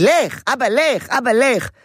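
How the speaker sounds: put-on high child voice pushing dad away; be the toddler sending dad off; toddler shooing. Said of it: "יכול להיות שיגיד".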